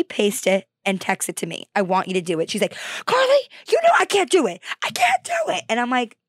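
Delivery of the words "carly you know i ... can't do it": high-pitched exasperated parent protest; mock helpless whine. Acting out mom's helpless complaint.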